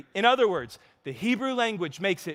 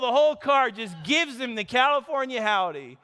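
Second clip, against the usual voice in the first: usual speaking voice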